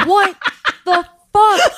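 high, loud, laughing disbelief; baffled outburst. Acted. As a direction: blurt it high and incredulous. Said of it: "And you're like".

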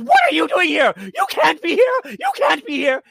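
high, loud mock shout at the intruder; shrill confrontation. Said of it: "and she says".

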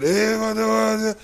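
slow bro call; frat bro. Retold or imitated. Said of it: "or that, that".

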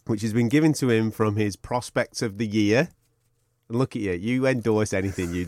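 ordinary talk, nothing marked

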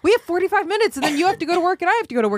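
high, urgent mock nagging; wife rushing her husband before work, high and pushy. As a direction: hurry him up, high and insistent.

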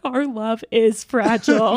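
laughing while half crying at the movie; laughing, mock tearful. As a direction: laugh out a mock-teary line, slowly. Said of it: "I was like".

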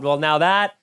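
ordinary talk

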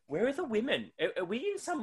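high-pitched baffled question; comically puzzled, voice lifted high; mock bewilderment.

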